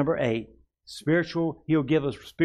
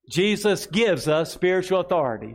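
slow and raised, for the church to repeat; slow proclaimed declaration; 6 semitones higher, 4 dB louder than usual. Shown as a demonstration. Pastor leading the congregation in a slow declaration. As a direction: declare it slowly and strongly. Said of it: "Say with me this here".

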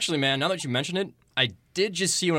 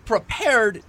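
indignant; 7 semitones higher, 5 dB louder than usual. Acted. Indignant retort in a quarrel.

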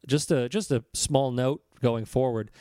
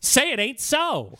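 high, slow, melodramatic plea; hammed-up disbelief, drawn out; mock dismay; 8 semitones higher, slower than usual. Co-host clinging to his vision of Australia, mock dismay.

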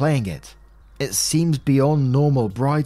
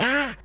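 imitated answering squeal; 12 semitones higher than usual. Retelling a TV friend echoing her, high mock squeal.

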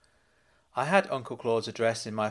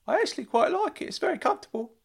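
Uncle Claude calling out; faster than usual. Acted. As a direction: squeal his name like a giddy fussing uncle. Uncle Claude voiced by narrator.

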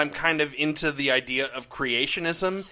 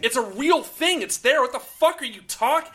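exasperated rant; 10 semitones higher than usual. Acted. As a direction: rant in disbelief.

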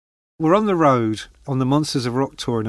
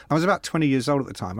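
ordinary talk

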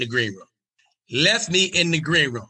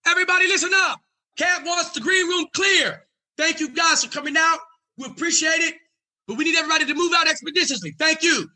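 booming security guard. Retold or imitated. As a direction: clear the room with authority. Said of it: "His security says".

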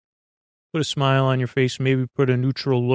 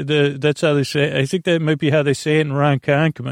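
ordinary talk

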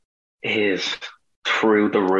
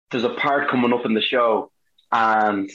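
normal talking voice

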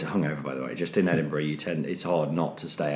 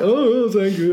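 high, louder, hammed-up gushing; mock delighted thanks; 12 semitones higher, 5 dB louder, slower than usual. Audience member taking a pie, mock delighted. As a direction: put on a chirpy thrilled thank-you.

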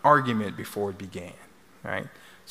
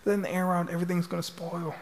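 retold scolding, pitched up without real volume; mock scream; 8 semitones higher than usual. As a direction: imitate an upset husband scolding in a high voice. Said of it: "he's screaming at her".

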